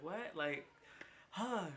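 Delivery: sudden high squeak of disbelief; stunned surprise. Retold or imitated. Telling how the surgeon's kind offer left her stunned, high surprised squeak. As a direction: caught off guard, pitch shoots up.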